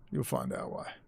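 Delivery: hushed ominous whisper. Real, not acted. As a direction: whisper it like a secret threat. Ominous teaser, then whispered.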